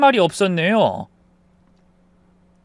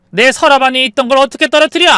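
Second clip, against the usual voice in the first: high, loud, disbelieving question; angry challenge; 9 semitones higher, 7 dB louder, faster than usual. Acted. Mother-in-law doubting daughter-in-law's diary excuse, angry challenge. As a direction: pounce on a feeble excuse.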